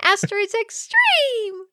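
high, slow, laughing title call; hyped announcer voice. Pretend.

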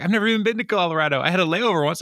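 high, fast flustered protest; mock flustered denial. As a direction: blurt it out caught in a lie. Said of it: "He's like".

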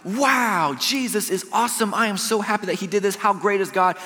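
high, bright "Wow", gushing; hammed-up delight; mock joyful praise. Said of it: "instead of being like".

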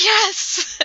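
a bright, loud, high cheer; excited yes. Retold or imitated. Enemy Mercy cheering as she runs off, loud high Yes. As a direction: yelp it out like a happy escape. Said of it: "she's just like".

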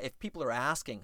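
ordinary delivery

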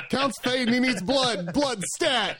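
high, urgent mock panic; high urgent Count voice. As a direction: squeak it out like a fading vampire. Voicing the fading Count.

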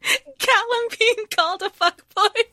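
high, loud through laughter; laughing. Real, not acted. Co-host cracks up naming a cringe moment, laughing. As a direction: squeal it out mid-giggle. Laughing while listing gripes.

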